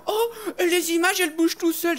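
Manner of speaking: high, loud, wide-eyed gasp of wonder; mock the amazed little boy; naive orphan. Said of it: "le petit orphelin qui dit".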